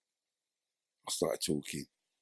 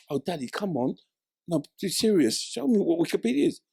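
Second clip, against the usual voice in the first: daughter's voice; 11 semitones higher, 4 dB louder than usual. Retold or imitated.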